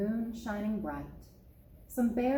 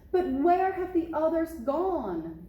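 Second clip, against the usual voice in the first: alarmed cry; 7 semitones higher, 4 dB louder than usual. Acted. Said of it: "Daddy Bear cried".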